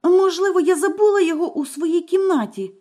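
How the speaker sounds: high, rushed frightened mumble; scared wife fumbling for an excuse; frightened babble. A narrator's reading. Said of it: "пробелькотіла дружина".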